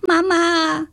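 put-on high, loud child's wail for mother; piercing, drawn call; crying out. A narrator's reading. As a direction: yell for Mum while fleeing. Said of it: "rannte er los und rief".

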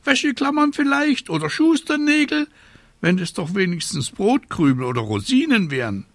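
very high, scolding bird voice; screeching raven. A narrator's reading. Raven scolding the witch over her rain, screeching. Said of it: "kreischte Abraxas".

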